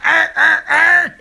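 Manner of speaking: high, loud mock grunting; laughing grunts. Imitating pumping sound, laughing.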